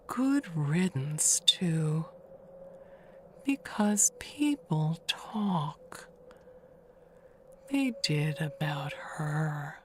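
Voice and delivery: slow, hushed, conspiratorial; gossiping whisper. Acted. The neighbour whispers town gossip to the new owner. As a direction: lean in and whisper the gossip slowly.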